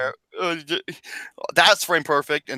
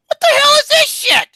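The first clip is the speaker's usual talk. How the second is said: very high, loud disbelief; appalled yell; 7 dB louder than usual. Real, not acted. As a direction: shriek the question in outrage. Shocked at what was sent.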